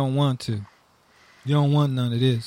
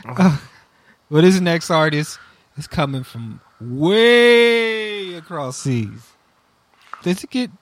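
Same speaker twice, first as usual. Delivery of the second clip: normal talking voice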